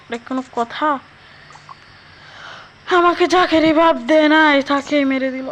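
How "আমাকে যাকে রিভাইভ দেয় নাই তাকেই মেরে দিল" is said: frustrated shout. Real, not acted. Teammate killed during match.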